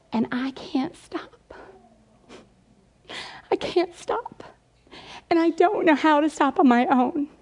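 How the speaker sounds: halting, pained retold confession; imitating bawling son. Son confessing to his mom, imitated tearful plea. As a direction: voice lifting, as if choking back tears. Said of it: "And he starts bawling".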